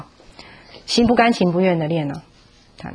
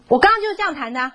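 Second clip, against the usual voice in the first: fast, higher, whiny retort; impatient child; 8 semitones higher, faster than usual. Retold or imitated. Child brushing off his mother's correction, impatient. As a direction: snap back like an annoyed kid. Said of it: "很不耐烦。".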